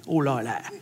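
put-on Cockney accent. Telling the audience how movie Londoners talk, Cockney accent. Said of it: "everybody talks like a Cockney".

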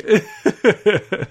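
high-pitched amused chuckle; high laugh. Real, not acted. Laughing at movie blurb.

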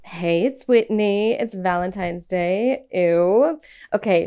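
ordinary talk

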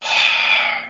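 voiceless exhaled sigh; acted sigh. Retelling Desire talking to the only survivor, acted sigh. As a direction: sigh it out in character.

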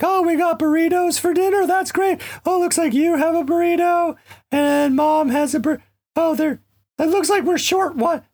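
very high voice, sliding into let-down; squeaky dog voicing a disappointed hope for burritos; Django's dog voice. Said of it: "that sort of high pitch became his voice".

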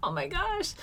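high-pitched mock shock; retold dismay. Retelling shock at lice news.

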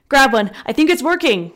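loud, high call over the noise. A narrator's reading. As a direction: shout it over the fire. Friend calls over the fire.

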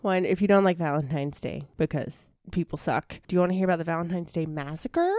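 ordinary talk, nothing marked

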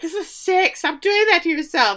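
high-pitched, disgusted protest; shrill mock horror at the sight. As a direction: squeal it out in horror. Shauna disgusted by Jackie.

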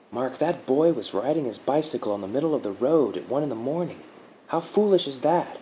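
a hint of a calm protector reassuring him; gentle guardian woman. A narrator's reading. Guardian explains calmly.

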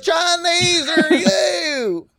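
high, slow, drawn-out mock cry; hammed-up mock demand. Mimicking a clueless question to friends, high hammed-up cry. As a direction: wail the question slowly, way up high. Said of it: "What type of Chinese?".

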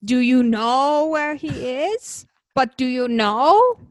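slow, put-on voice, each question rising; Edna Mode impression. Cohost showing her Edna Mode impression to the host, slow and rising. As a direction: lean on every word like a fussy designer. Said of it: "My Edna one would be like".

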